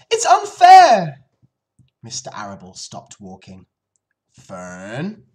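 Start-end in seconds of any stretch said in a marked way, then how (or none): 0.1-1.2 very high, drawn-out loud wail; sobbed protest. Acted. Fern protests to her father, loud sobbing wail. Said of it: "she sobbed". 4.3-5.3 gentle. Acted. Father stops walking to calm his daughter, gentle. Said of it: "he said gently".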